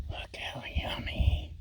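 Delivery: slow, soft whisper. Real, not acted. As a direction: breathe it out in awe. Extreme close-up of food.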